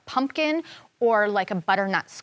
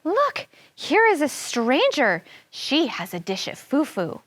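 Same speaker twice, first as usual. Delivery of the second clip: tinkling fairy voice; 4 semitones higher than usual. Acted. Fairy spotting the stranger, tinkling voice. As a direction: sound tiny and bright as a fairy. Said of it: "cried in a tinkling voice".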